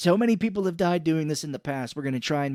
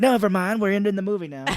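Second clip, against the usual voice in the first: higher, louder brush-off with a laugh; hammed-up flustered director; mock hasty dismissal; 6 semitones higher, 6 dB louder than usual. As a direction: wave it away and rush to the end.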